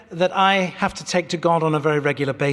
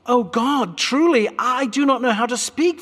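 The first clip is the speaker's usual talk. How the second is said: high, pleading whine; whiny boy Jeremiah; 7 semitones higher than usual. Acted. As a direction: plead like a frightened boy who wants out. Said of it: "stop your whining".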